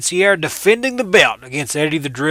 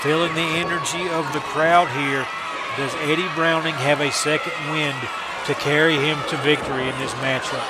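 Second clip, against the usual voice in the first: live wrestling play-by-play, rising to shouts at the finish; wrestling commentator. Acted. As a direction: call the match as it happens.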